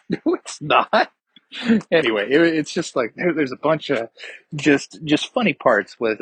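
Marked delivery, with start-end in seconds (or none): 0.1-1.1 mock retort. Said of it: "It's like".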